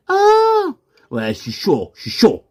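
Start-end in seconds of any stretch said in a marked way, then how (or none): 0.1-0.7 very high held ah; high-pitched warm-up cry; vocal warm-up. Shown as a demonstration. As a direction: leap up to a high sustained note. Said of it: "je me chauffe la voix".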